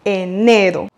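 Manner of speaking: enero: press the second E, e-NE-ro; stress on second E. Shown as a demonstration. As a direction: lean on NE in enero. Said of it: "accentuer sur le deuxième E".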